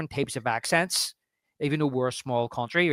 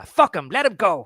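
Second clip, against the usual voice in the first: arrebato imitado y fuerte; 6 semitones higher, 5 dB louder than usual. Retold or imitated. Acts out movie line forcefully.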